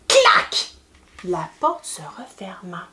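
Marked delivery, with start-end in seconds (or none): 0.1-0.7 high, sudden imitated clack; door slam noise. A narrator's reading. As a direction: crack it like a closing door. Train door slams shut.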